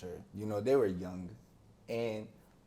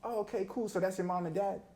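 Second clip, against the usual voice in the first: put-on much higher, light teen voice; mock curious friend; 8 semitones higher than usual. Teammate asking about his parents, mildly curious. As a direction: play the friend checking, casually curious. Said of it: "All my friends be like".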